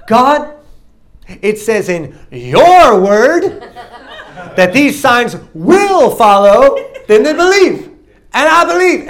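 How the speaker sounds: loud, high, stretched-out challenge to God; hammed-up, defiant praying; sassy prayer. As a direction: hurl it up at God with drawn-out sass. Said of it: "I prayed the sassiest prayer".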